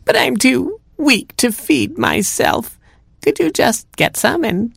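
very high, slow feeble granny; cat posing as grandma. Acted. Cat thanks Robin for food, feeble high granny voice. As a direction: play frail, drawn out up high.

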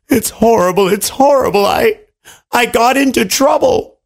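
high wail of dismay; storybook lament, pitched well up; distraught king. A narrator's reading. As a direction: plead in a hammed-up high panic. King wails to the bird.

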